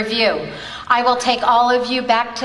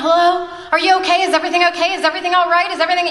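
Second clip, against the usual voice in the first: a mother fearing the worst for her firefighter son; frantic worried mom; 9 semitones higher, much faster than usual. Retold or imitated. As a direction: blurt out each question in a panic. Mother answers son's unexpected call.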